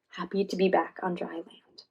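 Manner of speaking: plain reading voice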